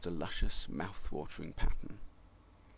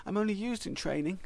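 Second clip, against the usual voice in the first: whiny high voice; 11 semitones higher, 3 dB quieter than usual. A narrator's reading. Pascal whines in complaint.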